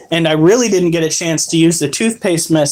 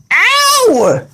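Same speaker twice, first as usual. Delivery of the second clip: sharp high ow; yelp of pain. Real, not acted. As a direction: yelp from a sudden bite. Bitten by pet.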